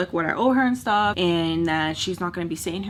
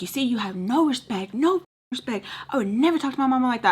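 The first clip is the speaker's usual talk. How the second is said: mock scolding; 5 semitones higher than usual. Imitating stepmom scolding.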